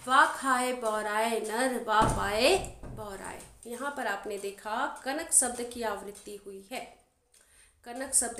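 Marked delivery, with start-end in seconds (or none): 0.1-3.4 slow, measured couplet recitation; verse recitation. Acted. Teacher reciting a couplet for students, slow verse recitation. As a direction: recite the verse with drawn-out syllables.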